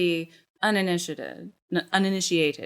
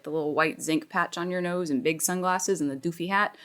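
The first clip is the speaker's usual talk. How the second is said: normal talking voice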